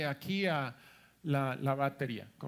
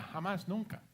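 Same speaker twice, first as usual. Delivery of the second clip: ordinary talk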